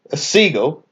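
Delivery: ordinary delivery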